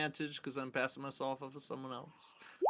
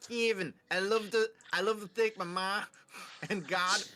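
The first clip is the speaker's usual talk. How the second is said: mock acceptance speech; 5 semitones higher than usual.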